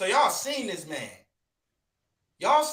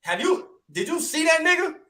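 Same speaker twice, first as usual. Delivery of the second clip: high, street-slang retelling of the Bible line; 11 semitones higher, 3 dB louder than usual. Retold or imitated. Bible reading, men of Israel as street guys.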